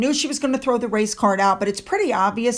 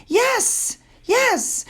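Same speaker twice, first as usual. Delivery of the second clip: yes, yes pitched way up, mocking; sarcastic yes; 11 semitones higher than usual. Real, not acted. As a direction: jump high on both yeses, sarcastic. Mock agreement, sarcastic emphasis.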